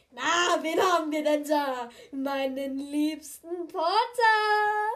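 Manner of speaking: loud, slow hearty greeting; jovial uncle voice. Acted. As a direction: boom it out like a jolly uncle. Ron greets loudly.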